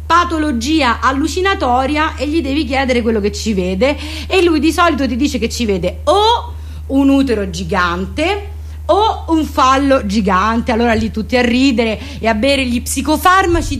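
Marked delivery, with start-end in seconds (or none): none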